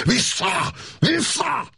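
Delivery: sudden high shouted command; desperate yell of Vissza. A narrator's reading. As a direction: bellow it to drive the tiger back. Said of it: "elüvöltötte magát".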